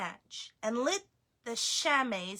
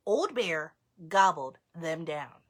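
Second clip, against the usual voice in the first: ordinary delivery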